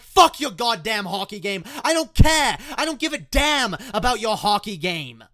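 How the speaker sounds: high, loud furious yell; snapping back angrily. Retold or imitated. Kid snapping back at dad over his hockey game, furious. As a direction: yell it back, hitting every curse. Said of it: "I fucking snapped back".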